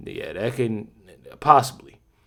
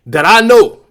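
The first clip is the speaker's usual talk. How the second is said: KNOW punched loud and high; emphatic insistence; 7 semitones higher, 11 dB louder than usual. Real, not acted. Man insisting on what he has witnessed, KNOW stressed loudly. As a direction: hit KNOW much louder and higher.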